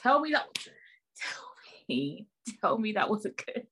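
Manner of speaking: high breathy whispered tease; mock seductive whisper; sultry whisper.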